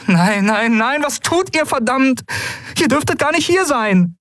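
very high-pitched, agitated outburst; alarmed protest. A narrator's reading. Pilot finding friends aboard who shouldn't be, alarmed. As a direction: protest in dismay, voice pitched right up.

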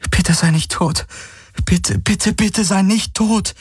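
high plea, rising and slowing at the end; desperate pleading. A narrator's reading. Kirai finds Hawks motionless, desperate high pleading. Begs fallen friend not to die.

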